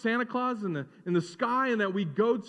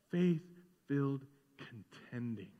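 slow and softer, drawn out; a soft, slow first take; hushed emphasis; 8 semitones lower, 10 dB quieter, slower than usual. Acted. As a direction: breathe the words reverently. Repeated phrase, first soft take.

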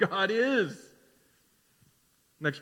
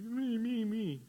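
higher, softer drawn-out mock whine; mock whining; 4 semitones higher, 6 dB quieter, slower than usual. Pastor imitates Job whining to God, mock whine. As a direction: imitate a self-pitying whiner. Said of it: "He's whining".

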